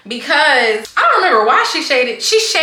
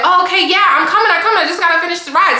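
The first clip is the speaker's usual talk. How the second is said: higher, hurried mock-exasperated reply; mock annoyance; 6 semitones higher, faster than usual. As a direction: imitate a driver brushing off a nagging caller. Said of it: "So now he getting annoyed".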